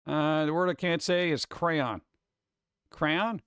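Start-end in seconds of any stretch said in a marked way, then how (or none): none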